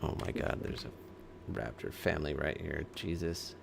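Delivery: soft, breathy hush at danger; quiet gamer panic; hushed alarm. Real, not acted. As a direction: whisper so the raptors don't hear. Sudden game danger.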